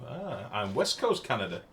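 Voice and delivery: ordinary talk, nothing marked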